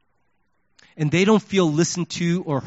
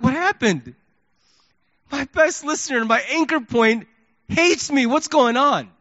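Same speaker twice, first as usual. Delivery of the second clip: higher, wounded disbelief; hurt; 6 semitones higher than usual. Retold or imitated. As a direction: plead for an explanation, retelling your hurt. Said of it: "I was so hurt".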